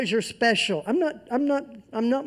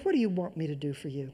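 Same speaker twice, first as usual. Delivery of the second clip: tender Jesus; 6 semitones lower, 9 dB quieter, much faster than usual. Acted. Preacher voicing Jesus to beggar.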